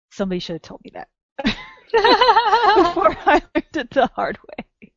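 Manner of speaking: amused laugh mid-sentence. Real, not acted. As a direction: giggle it out. Laughing while speaking.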